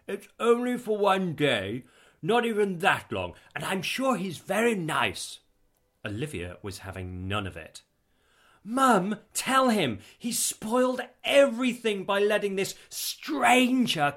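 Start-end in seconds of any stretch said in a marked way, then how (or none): 0.1-5.4 high, raised voice, placating; insistent, higher-pitched reassurance; pacifying. A narrator's reading. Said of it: "trying to pacify his daughter's histrionics". 8.6-14.1 high, loud histrionic complaint; dramatic, outraged daughter; indignant appeal. A narrator's reading. Daughter appeals to mother against father.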